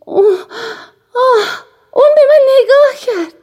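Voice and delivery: high, loud excited squeal rising to the end; a girl squealing that her crush looked at her. A narrator's reading. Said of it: "او جیغ کشید".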